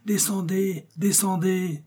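high distant call; the camp calls Athos down, high and far-off; shouting. A narrator's reading. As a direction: voice the whole camp calling from afar. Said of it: "tout le camp qui criait".

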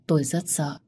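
usual speaking voice

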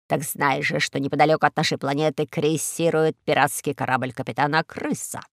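low, confiding villain voice; scheming eagle. Acted. Eagle reveals the pirate plot to Карло, low and smug. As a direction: lay out the plot in low tones.

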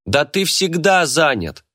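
high, slow angry outburst; angry shout. A narrator's reading. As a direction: yell it after him in anger. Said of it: "крикнул я ему вслед".

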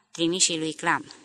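ordinary delivery, nothing marked

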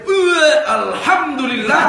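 put-on high, loud, slow drunken cheer; mock drunk delight. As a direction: ham up a tipsy thank-God. Drunk man praising after drinking. (sorakan mabuk yang dibuat-buat)

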